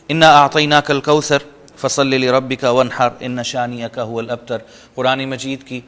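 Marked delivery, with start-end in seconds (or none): 0.1-4.6 Quranic recitation. Acted. Teacher reciting Surah al-Kawthar for the class. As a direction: intone the surah.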